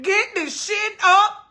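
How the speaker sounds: high, slow mock yell; snapping at husband again; retold cussing. Wife cussing out husband in bed, slow mock yell. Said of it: "I cussed him right on out".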